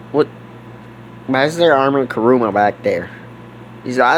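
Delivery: low, gruff street buddy; deep character voice. Pretend. Buddy greeting Bobby before taking his car, low and gruff. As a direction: drop into a low tough-guy voice.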